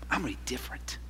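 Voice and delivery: barely whispered, tearful promise; an intimate, broken-down whisper; hushed vow. Retold or imitated. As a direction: whisper it through tears, very low.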